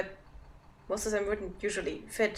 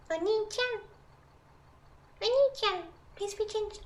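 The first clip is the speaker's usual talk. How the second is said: much higher, softer second try; even higher voice; 12 semitones higher, 3 dB quieter than usual. Pretend. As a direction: push it higher, squeaky and small. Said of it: "How do you do high-pitched voice?".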